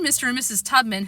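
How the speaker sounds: ordinary delivery, nothing marked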